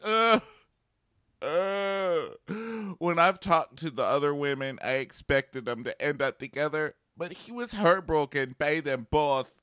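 high, loud hammed-up maternal wailing; exaggerated tearful mom impression; mock sobbing mom. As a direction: imitate a mother sobbing over her son, building to a high wail. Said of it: "already sobbing on the couch for no reason".

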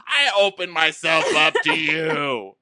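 loud, high, drawn-out wail; mock anguished shout. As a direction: cry it out with hammed-up anguish. Acting the man's wounded climax.

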